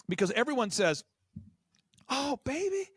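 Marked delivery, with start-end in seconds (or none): 2.1-2.9 high, gushing imitation of a wife cooing over a puppy; mock wife voice. Imitating a wife begging her husband for a puppy, high and gushing. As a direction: coo it up high like a smitten wife.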